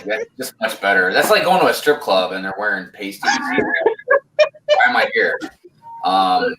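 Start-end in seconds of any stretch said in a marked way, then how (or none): 3.2-5.4 mock outraged cry.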